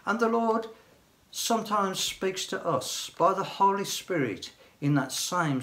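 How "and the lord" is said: ordinary talk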